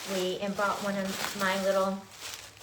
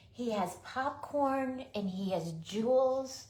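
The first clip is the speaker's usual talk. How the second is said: ordinary talk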